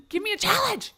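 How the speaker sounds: loud, high, playful demand; mock demand. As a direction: burst into a hammed-up shout.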